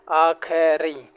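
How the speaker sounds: drawn-out slow आखिरी, teacher drilling; slow drilled word. Shown as a demonstration. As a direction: say आखिरी slowly, syllable by syllable. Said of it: "slow correct word drilled".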